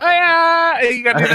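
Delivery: loud, high put-on anime squeal; cute anime voice. Joining the co-host's cute anime bit, hammed-up squeal. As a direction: squeal it like a mock anime girl. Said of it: "some cute anime thing we can say".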